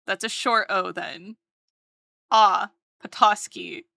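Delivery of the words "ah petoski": normal talking voice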